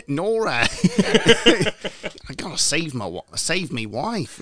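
high, slow, laughing Australian voice; mock Australian accent. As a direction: ham up an Aussie Mr Freeze. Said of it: "can you just do it Australian".

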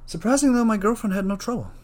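high, hammy punchline voice; exaggerated high-pitched quip; put-on joke voice. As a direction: deliver a corny punchline way up high. Delivers a lame joke in put-on voice.

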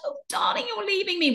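a high, breathy, mock-hurt agent; mock-wounded agent. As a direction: send up the agent's fake hurt. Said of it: "she's like".

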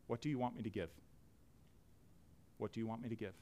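soft, humble plea to God; hushed prayer. Acted. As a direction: pray it quietly, almost inward. Quiet prayer to the Lord.

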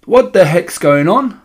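put-on puzzled spoilt child; indignant boy. A narrator's reading. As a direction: demand an answer, drawing it out. Impatient boy questioning the witch.

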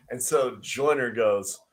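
ordinary delivery

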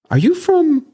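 friend's question in a high put-on voice; imitating his friend. Voice-actor friend asks where he's from, high put-on voice. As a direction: pitch way up to play the friend asking. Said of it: "He's like".

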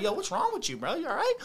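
baffled check-in at a raging man; startled concern. Retold or imitated. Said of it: "I was like".

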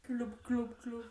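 fish gurgle noises; gurgling fish man croak. Pretend. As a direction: glub like a fish. Playing Mister Fish.

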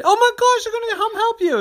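very high, loud alarm; panicked boy. Acted. Terry panics about his mommy's blood, very high and loud. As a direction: freak out at the blood. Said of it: "Terry said".

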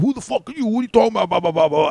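high, loud mocking yell of an aggressive guy; mock angry yell. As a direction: ham up the guy picking a fight. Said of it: "And he goes".

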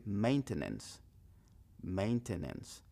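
"maintenance" slow, mein-te-nens; careful full "Maintenance"; slow full version. Shown as a demonstration. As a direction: spell out every syllable of "maintenance". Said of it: "O puede decir".

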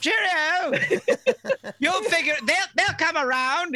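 put-on high, hammy English accent; high hammed-up English accent; mock British accent. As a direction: parody a chirpy Brit. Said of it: "mocking the British kid".

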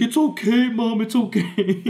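put-on crying child. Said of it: "crying myself 'cause I was in pain".

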